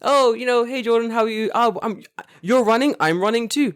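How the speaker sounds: high, breezy pretend greeting; mock friendly greeting. Runner imagines a polite greeting from old man, high. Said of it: "it wasn't like a".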